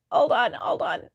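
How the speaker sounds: breathless whispered plea; gasping whisper, begging for a pause; whispered hold on. Retold or imitated. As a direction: whisper it while laughing too hard to walk. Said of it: "She was like".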